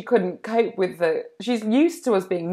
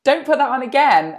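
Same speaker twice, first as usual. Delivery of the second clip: loud, higher mock exasperation; Ross from Friends; 4 semitones higher, 7 dB louder than usual. Ross begging Marcel to stop the music, mock exasperated plea. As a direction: plead loudly, no accent. Quoting Ross pleading with monkey.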